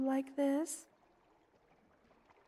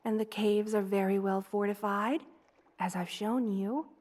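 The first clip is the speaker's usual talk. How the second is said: normal talking voice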